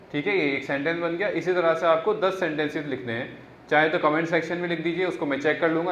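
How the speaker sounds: ordinary talk, nothing marked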